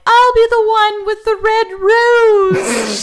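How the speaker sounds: high, loud dating-profile voice; hammed-up blind date line, high and loud; mock blind date voice. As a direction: sing it out like a hopeful blind date. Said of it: "you know how people are like".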